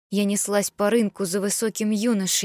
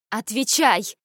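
sharp raised bark; barked order; 3 semitones higher, slower than usual. A narrator's reading. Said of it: "рявкнула я". (резкий окрик)

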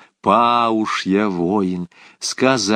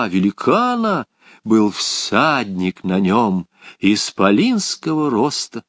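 ordinary delivery

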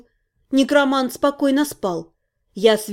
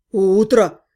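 grumbling; 6 semitones lower than usual. A narrator's reading. Half-gnome grumbling at the girl, sour. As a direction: lower grumbled retort. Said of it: "проворчал полугном".

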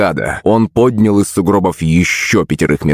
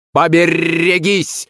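parrot cry; 10 semitones higher, slower than usual. Acted. Parrot diving at the dead.

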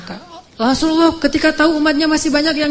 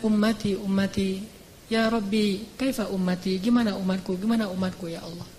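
softer, tender worried cry for his people; anguished plea; 4 semitones lower, 8 dB quieter than usual. Acted. The Prophet worries over his people, soft anguished plea. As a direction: murmur it like a grieving plea.

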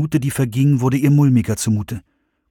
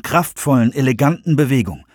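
plain reading voice

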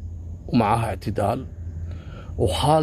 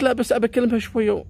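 pleading friend; 9 semitones higher, faster than usual. Retold or imitated. Eatidal voiced higher, insisting.

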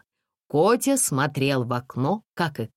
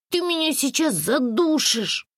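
put-on much higher child voice, protesting; a squirming kid complaining; indignant boy; 13 semitones higher than usual. A narrator's reading. Arkasha protests his mother's tight hug, indignant. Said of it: "возмутился Аркаша".

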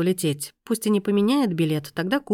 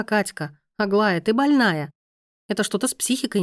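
ordinary delivery, nothing marked

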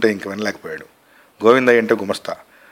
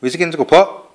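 raised, higher-pitched irritation; loud dismissal; 6 semitones higher, 3 dB louder than usual. A narrator's reading. Husband tells pestering boy to go, loudly. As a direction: send him away sharply. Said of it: "గట్టిగా అన్నాడు".

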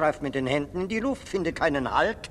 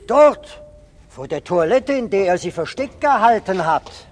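excited; 4 semitones higher, 8 dB louder than usual. Acted. Policeman points excitedly.

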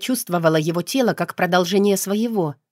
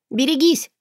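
higher urgent exclamation; warning cry; 7 semitones higher than usual. A narrator's reading. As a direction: warn him sharply. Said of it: "воскликнула я". (тревожный возглас)

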